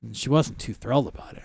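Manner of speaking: normal talking voice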